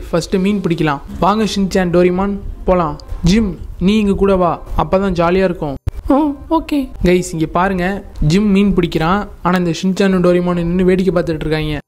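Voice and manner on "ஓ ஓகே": put-on high-pitched little kid voice; reply in a small, much higher child's voice; lost child. Child agrees to join fishing trip, high child voice.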